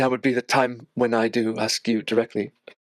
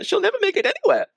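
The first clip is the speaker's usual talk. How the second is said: despairing cry; 3 dB louder, faster than usual. Acted. Mary wailing to Ismark that her sister is lost. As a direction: voice cracks up much higher in despair. Mary's despair breaks through.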